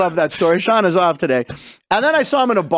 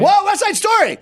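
much higher, delighted exclamation; excited whoa; 14 semitones higher than usual. Real, not acted. Host cheering the producer's correct movie guess, excited. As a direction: cheer the right answer. Host reacts to right answer.

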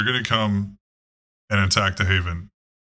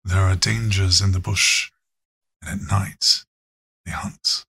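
nature show narrator. Acted. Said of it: "some dude talking about lions and tigers and bears".

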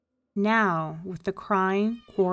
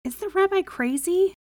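high, louder, rising question; incredulous thought; 11 semitones higher, 6 dB louder than usual. A narrator's reading. Poor man wondering about the rabbi, incredulous. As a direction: wonder in high-pitched disbelief. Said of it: "he thought".

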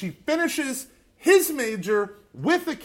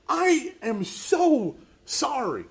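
slow apology; 7 semitones higher, 4 dB louder, much slower than usual. Retold or imitated. Father apologizing, retold.

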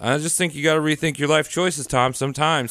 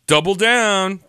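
ordinary talk, nothing marked

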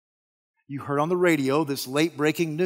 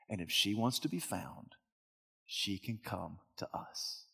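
low, soft, fading confidential tone; smug quiet excuse; 6 semitones lower, 8 dB quieter than usual. Acted. Camp man explaining why they barely search, low and quiet. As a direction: drop your voice as if sharing a secret.

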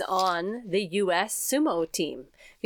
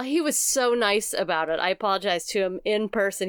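ordinary talk

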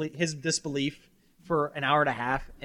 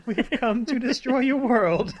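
high, loud, drawn-out alien threat; mock menacing movie-villain announcement; invader voice; 8 semitones higher, 7 dB louder, slower than usual. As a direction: proclaim doom slowly in a raised voice. Quoting movie's invaders, praised right after.

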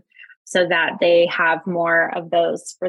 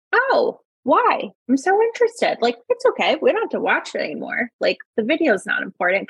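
eager interest; 6 semitones higher than usual. Shown as a demonstration. Modelling eager reply to child.

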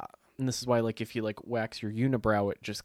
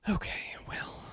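whispered awkward okay; 7 dB quieter, slower than usual. Real, not acted. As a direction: hush it out. Awkward pause before intro.

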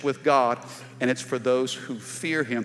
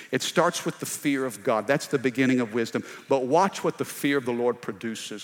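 ordinary delivery

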